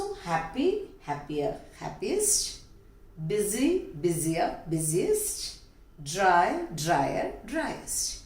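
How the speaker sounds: slow, drawn-out word drill. Shown as a demonstration. Teacher drilling students on comparatives, slow and drawn out. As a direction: spell each form out slowly for the class. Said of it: "teacher drills comparative forms".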